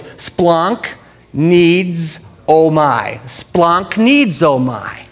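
"Splunk needs oh my" drawn out in separate chunks; demonstrated pronunciation. Shown as a demonstration. Preacher teaching the congregation, "Splunk needs oh my" slow and drawn out. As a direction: stretch "Splunk needs oh my" slowly, piece by piece. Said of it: "It goes".

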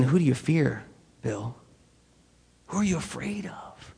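very soft, near-whispered imitation of God's voice; hushed questioning. God privately questioning the preacher's fear, hushed. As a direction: drop to a hush and ask searchingly.

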